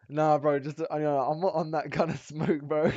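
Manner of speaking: high, loud laughing denial; loud excited protest. Real, not acted. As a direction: protest loudly, cracking up. Laughing disbelief at suggestion.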